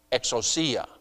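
exousia said as ek-soo-SEE-a, stress on the third syllable; Greek pronunciation. Shown as a demonstration. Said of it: "the emphasis in the pronunciation on the C".

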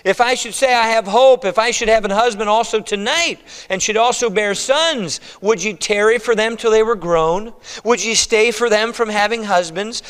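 high, preached plea; impassioned urging. Acted. Naomi reasons with her daughters-in-law, urging them impassionedly. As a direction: drive the argument home at a high pitch.

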